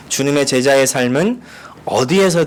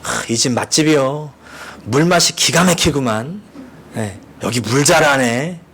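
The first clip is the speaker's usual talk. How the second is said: a lightly voiced country friend praising the water; Chungcheong friend. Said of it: "충청도 출신 친구가 이렇게 말했다는".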